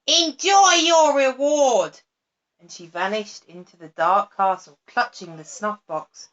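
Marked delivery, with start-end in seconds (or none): 0.1-2.0 louder, higher, slow gloating. A narrator's reading. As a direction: savour the cruelty slowly. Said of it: "cried the witch".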